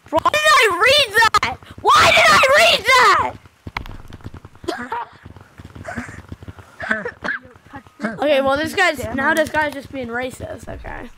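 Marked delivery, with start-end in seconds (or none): 0.1-3.3 high, loud cry of regret; appalled shout. Real, not acted. Streamer appalled at what he read from chat, appalled shout. 3.4-8.0 long, breathless, voiceless laugh; helpless wheezing laugh, much softer. Real, not acted. Streamer cracking up at a chat message, laughing.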